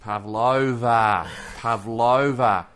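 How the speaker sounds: slow, loud pav-LO-va; broad Australian pronunciation. Shown as a demonstration. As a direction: stretch the middle of Pavlova, big and broad. Co-host demonstrates the Australian pronunciation.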